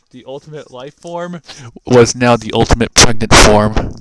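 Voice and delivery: building to a huge roaring announcement; dramatic booming reveal. A narrator's reading. Narrator roaring the big reveal to listeners. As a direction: blast the punchline out like a trailer voice.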